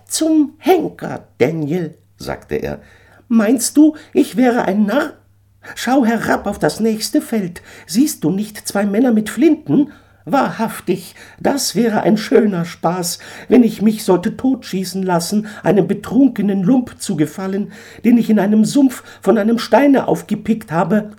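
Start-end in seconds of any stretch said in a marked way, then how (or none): 0.1-2.0 much higher, loud bird voice; a proud eagle laying down terms; haughty eagle. Acted. Eagle urges Daniel off the sinking stone, high and haughty. 3.3-21.1 much higher, louder mocking bird voice; scornful eagle. Eagle figure held voice.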